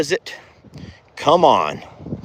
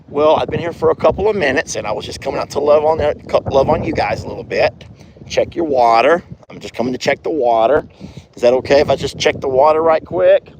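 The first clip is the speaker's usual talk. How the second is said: fast rambling excuse; faster than usual. Real, not acted. Rushed excuse to horses.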